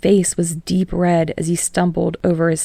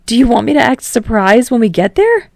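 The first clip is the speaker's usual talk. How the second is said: put-on higher, louder eager young man; Kirishima voice; 5 semitones higher, 6 dB louder than usual. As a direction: lift it up with excitement. Kirishima playful offer.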